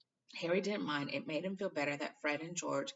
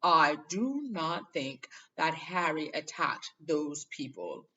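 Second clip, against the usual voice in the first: loud and firm; 7 dB louder, much slower than usual. A narrator's reading. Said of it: "said Dumbledore loudly".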